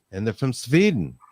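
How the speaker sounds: light mock Swedish accent; a hint of Swedish lilt. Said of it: "the best Swedish accent I could come up with".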